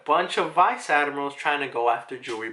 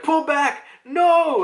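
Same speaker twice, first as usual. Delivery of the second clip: high, loud frantic battlefield shout; panicked yell; 7 dB louder, much slower than usual. Retold or imitated. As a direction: scream the retreat order in alarm. Soldiers under attack yelling.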